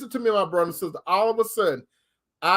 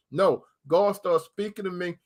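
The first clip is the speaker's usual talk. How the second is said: ordinary delivery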